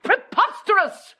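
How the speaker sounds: high, slow, drawn-out outburst; outraged exclamation. Acted. Wife scoffing at Slope as dean, outraged. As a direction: pitch it high and stretch the scorn.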